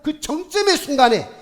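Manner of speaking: sudden high, loud cry at the climax; rising shout. Real, not acted. Pastor to congregation, crying out the climax. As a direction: burst out on the peak moment. Preacher building to a peak.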